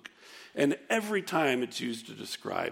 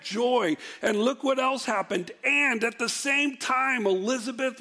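raised, emphatic sermon delivery, pitched higher; fired-up preaching; 9 semitones higher, 4 dB louder than usual. Acted. Pastor stirring up his congregation about the baby's leap, higher and emphatic. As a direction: preach it with rising enthusiasm.

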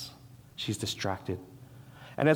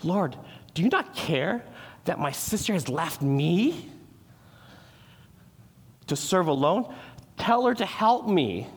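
complaining Martha; 5 semitones higher than usual. Retold or imitated. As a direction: act out her exasperated demand. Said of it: "possessed her to say such a thing to the Lord".

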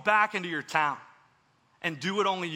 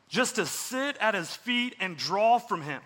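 ordinary talk

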